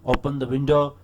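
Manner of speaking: loud, blunt order; loud command. Shown as a demonstration. Teacher re-showing the interjection read loud and commanding. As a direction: bark the command. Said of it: "ऊंचा पढ़ा इसको".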